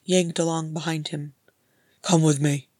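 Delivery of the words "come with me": louder growled command. Acted. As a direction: growl the order. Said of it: "Thorin growled".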